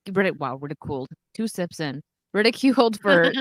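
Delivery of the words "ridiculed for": laughing through the words, slowed right down. Real, not acted. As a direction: giggle while you say it. Laughing at the accent.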